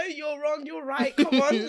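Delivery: high, loud and laughing, playing a lawyer; mock courtroom lawyer. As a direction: ham up a fiery lawyer arguing a case.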